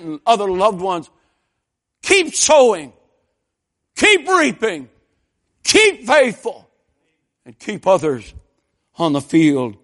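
high shouted exhortation, punched phrase by phrase; full-force preaching. Acted. Preacher urging the congregation to keep sowing and reaping, full-force preaching. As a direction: belt out each rallying phrase, then hammer the list loudly.

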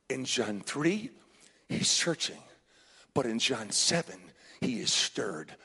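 very low, hushed pulpit delivery; grave, quiet storytelling from the pulpit; solemn preaching. Real, not acted. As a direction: let each phrase land softly, with long pauses.

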